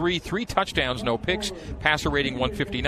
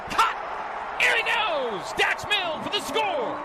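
soaring high excited play-by-play; touchdown call; much slower than usual. Real, not acted. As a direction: call the catch at full thrill. Replayed radio touchdown call.

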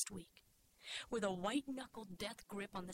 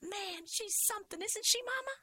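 put-on high-pitched child's delight; thrilled little girl; 13 semitones higher, 3 dB louder than usual. A narrator's reading. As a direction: admire Grandma like an excited nine-year-old. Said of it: "was thrilled".

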